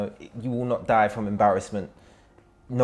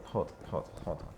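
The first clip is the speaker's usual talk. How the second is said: breathy, much softer "hold on"; half-whispered disbelief, softer; 3 semitones lower, 13 dB quieter than usual. Real, not acted. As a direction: drop to an amazed whisper. Interrupting in amazement.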